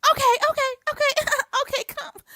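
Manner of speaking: very high, loud, laughing fake okays; mock giggly surrender. Mocking her giving in.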